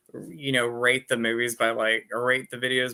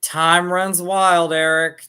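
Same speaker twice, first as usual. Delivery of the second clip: dramatic movie quote; 6 semitones higher, 9 dB louder, slower than usual. Pretend. Quoting movie line dramatically.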